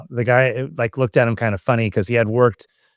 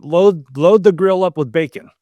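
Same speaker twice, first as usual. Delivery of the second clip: louder, higher boss shouting to the kitchen; dad urging the cook, a loud mock kitchen call; calling out an order; 6 semitones higher, 5 dB louder than usual. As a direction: bark it across a busy grill.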